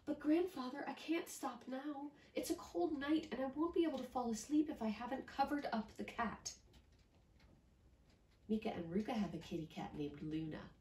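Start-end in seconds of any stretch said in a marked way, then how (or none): 0.1-6.5 put-on higher, hurried child insisting; a child who cannot sleep yet; little girl voice. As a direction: rattle it off like an eager little girl. Miyuki voice.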